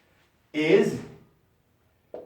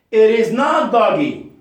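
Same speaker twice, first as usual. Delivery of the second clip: It is NOT doggy, NOT punched loud; teacher stressing not; 6 semitones higher, 8 dB louder than usual. Shown as a demonstration. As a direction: hit not hard and loud.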